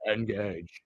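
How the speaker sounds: low Engage. in a French accent. Shown as a demonstration. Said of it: "I used a French accent instead".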